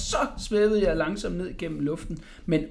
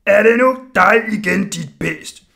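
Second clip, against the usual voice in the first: loud bellow, raised pitch; angry roar; 4 semitones higher, 12 dB louder than usual. A narrator's reading. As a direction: bellow it furiously at a culprit. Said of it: "brølede smeden".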